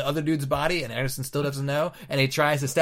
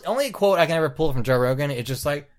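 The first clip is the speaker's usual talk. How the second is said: ordinary delivery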